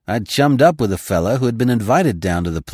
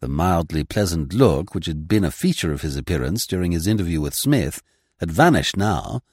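plain reading voice

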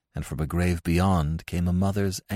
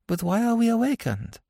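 high-pitched wondering question; puzzled dead wife. Acted. Old wife asks her husband in the grave, high and puzzled. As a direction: ask it in a high voice, falling at the end.

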